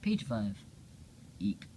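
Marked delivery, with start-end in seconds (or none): none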